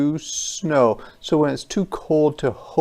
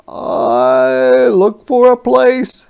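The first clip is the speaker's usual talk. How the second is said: high, loud, slow deer voice; a gentle searching deer; 12 semitones higher, 9 dB louder, slower than usual. Acted. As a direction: deer looking for shelter. Deer speaks in story.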